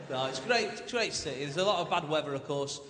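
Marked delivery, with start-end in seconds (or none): none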